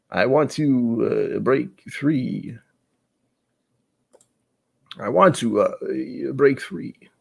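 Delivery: drawn-out imitation of someone's voice; imitated singer's voice. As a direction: stretch it out like a famous voice. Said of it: "what's his name's voice".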